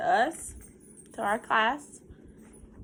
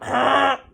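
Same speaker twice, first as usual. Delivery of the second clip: mock honk; 2 semitones higher, 5 dB louder than usual. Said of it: "You sound like a sick duck".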